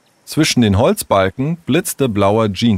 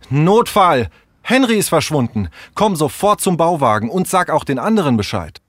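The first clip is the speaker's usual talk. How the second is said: higher-pitched, pressing emergency call; urgent alarm; 7 semitones higher than usual. Acted. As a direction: raise the alarm and rally friends.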